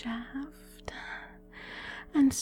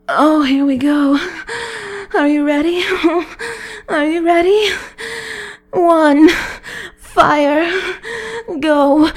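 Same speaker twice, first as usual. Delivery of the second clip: louder and higher, rising to a peak; building excitement; 4 semitones higher, 6 dB louder than usual. Acted. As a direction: push the energy up to climax. Countdown builds to climax.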